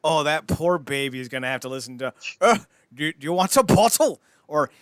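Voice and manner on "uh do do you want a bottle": loud, higher put-on voice; awful-sounding parent. Parent offering the poor baby a bottle, mock awful voice. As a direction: blurt it as a comically grating parent. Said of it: "you're both the worst sounding".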